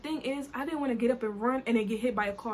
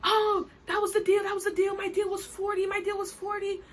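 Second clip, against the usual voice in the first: imitating the driver getting mad, a hammed-up protest; imitating the angry driver; 8 semitones higher than usual. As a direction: squeal his protest in a put-on voice.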